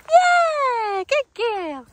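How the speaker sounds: high, drawn-out cheer; excited dog praise. Real, not acted. Trainer rewards dog for coming back, excited praise. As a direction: make a huge fuss of the dog.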